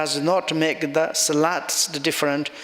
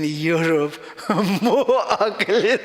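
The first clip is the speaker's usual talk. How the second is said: laughing; 3 semitones higher, 3 dB louder than usual. Real, not acted. Laughing at the retold comeback.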